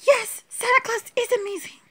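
high-pitched play voice agreeing; squeaky doll voice. Acted. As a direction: chirp it as the little doll. Same line in doll voice.

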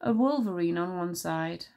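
usual speaking voice

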